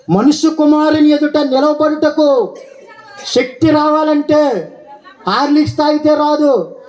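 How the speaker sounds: high, sustained pulpit cry; ringing preacher's chant on one high note; full-force preaching. Acted. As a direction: declaim each phrase high, stretching the endings.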